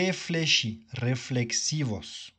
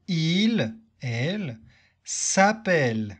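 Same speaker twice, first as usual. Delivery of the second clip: conjugation drill; 3 dB louder than usual. Shown as a demonstration. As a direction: spell it out form by form, with a clear landing on each verb. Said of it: "Tu répètes la conjugaison de ce verbe avec moi?".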